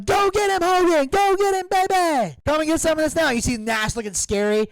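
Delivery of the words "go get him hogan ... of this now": high, loud cheering crowd call; imitating the crowd, high and loud; crowd yelling. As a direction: yell it like a fan in the stands. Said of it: "they're yelling".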